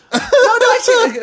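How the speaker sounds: very high, loud squeal; mock terror breaking into laughter; panicked insistence. Girlfriend insisting she sees a claw, panicked.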